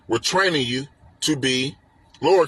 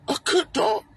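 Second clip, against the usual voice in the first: dog-praise voice; 10 semitones higher than usual. Shown as a demonstration. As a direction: coo it like praising a puppy.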